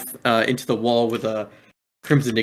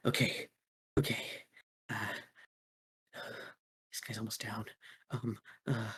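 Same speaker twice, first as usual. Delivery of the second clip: much softer private muttering; thinking to himself; 16 dB quieter than usual. Acted. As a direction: talk it under your breath to yourself. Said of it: "and think to himself".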